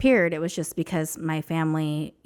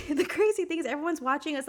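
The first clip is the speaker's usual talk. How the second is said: laughing; 9 semitones higher than usual. Real, not acted. Laughs while telling.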